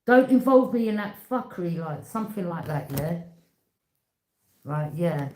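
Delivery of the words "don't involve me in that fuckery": loud, higher snapping rebuke; mock angry telling-off. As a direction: shut them down hard. Acted cussing someone out.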